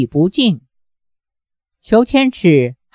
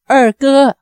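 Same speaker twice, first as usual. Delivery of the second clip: a higher-pitched, understated cry to a brother; calling out loudly; 5 semitones higher than usual. A narrator's reading. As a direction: call back to a long-lost brother. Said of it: "也大声叫了出来". (高声呼唤，略带激动)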